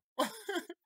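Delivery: high, loud delighted laugh; burst of laughter. Real, not acted. Laughs at own joke.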